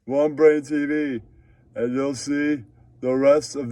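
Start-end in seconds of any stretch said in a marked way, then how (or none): none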